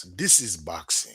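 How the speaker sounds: lightly voiced boxer impression, low pitch; Triple G. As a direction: drop the pitch for a boxer's catchphrase. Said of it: "in my Triple G voice".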